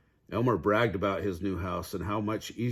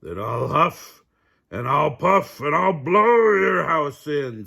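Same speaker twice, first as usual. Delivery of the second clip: building to a loud, slow threat; menacing wolf; 6 semitones higher, 8 dB louder than usual. A narrator's reading. Wolf threatening the pigs, growing louder. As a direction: swell into a booming threat.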